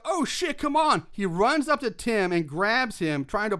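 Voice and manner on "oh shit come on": higher-pitched mock urgent yell; retold yell. Eddie warning Tim of danger, mock yelling. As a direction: imitate a yelled warning, half-voiced. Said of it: "So he yells out".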